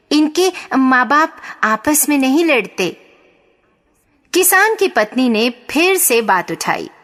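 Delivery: ordinary delivery, nothing marked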